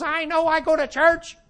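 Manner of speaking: put-on high, laughing old-lady voice; high mock old lady brushing him off. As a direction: play the unimpressed old lady. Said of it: "And she goes".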